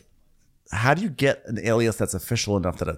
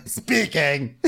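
fake Danielle; 6 semitones higher than usual. Playing Danielle answering the telemarketer.

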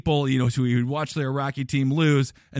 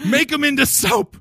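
much higher, louder shouted chant; hammed-up stadium yell; mock shout; 9 semitones higher, 5 dB louder than usual. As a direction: bellow it like a crazed fan. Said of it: "Make the other team into soap".

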